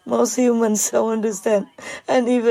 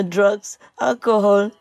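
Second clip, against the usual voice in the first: ordinary talk, nothing marked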